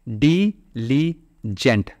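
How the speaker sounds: डिलीजेंट slowly in three beats, di-li-jent; syllable by syllable. Shown as a demonstration. Teacher counting three syllables, डिलीजेंट slowly broken apart. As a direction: separate each syllable of डिलीजेंट with a pause. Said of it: "तीन syllable".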